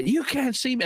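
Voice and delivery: high mock kid imitating Cena; little kid's brash Cena catchphrase; kid doing John Cena. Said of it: "do your best John Cena impersonation".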